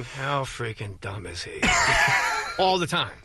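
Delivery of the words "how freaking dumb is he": lower, softer and slow, a disdainful inner voice; wife's inner thought. Retold or imitated. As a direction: think it slowly and quietly, looking down on him. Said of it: "she's thinking".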